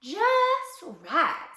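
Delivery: high, pleased pronouncement; pleased approval. A narrator's reading. Dusty Locks tries the straw mattress, pleased. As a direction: pitch it up with satisfaction.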